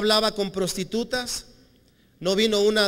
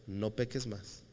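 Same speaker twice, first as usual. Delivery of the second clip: low and soft; tender command; 8 semitones lower, 12 dB quieter, slower than usual. Retold or imitated. Jesus quietly telling the woman to sin no more, low and soft. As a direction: say it softly, kindly firm.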